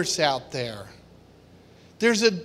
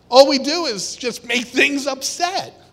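ordinary delivery

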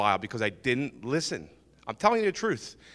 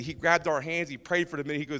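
ordinary talk

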